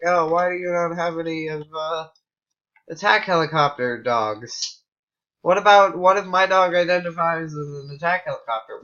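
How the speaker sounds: hammed-up indignant gamer demanding options; mock outraged complaint. Mocking an imagined player who wants attack helicopter dogs, hammed-up outrage.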